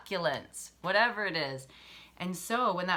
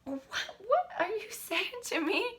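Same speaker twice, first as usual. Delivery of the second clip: high, indignant mock outrage at the friend; outraged friend; 13 semitones higher than usual. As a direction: play an offended friend, pitched way up. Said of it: "You'd be like".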